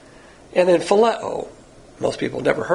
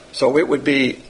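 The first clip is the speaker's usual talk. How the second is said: normal talking voice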